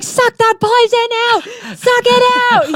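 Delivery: very high, drawn-out mock shriek; crazy kid yelling. Mimicking the kid after the bee sting, crazed high yell. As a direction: shriek it slowly like a crazed child. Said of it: "He was so crazy".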